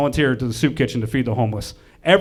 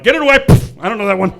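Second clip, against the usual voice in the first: high, loud recoil; hammed-up panic at a stranger's kid; mock alarm; 8 semitones higher, 7 dB louder than usual. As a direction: shoo it away in comic alarm. Acted alarm at unknown child.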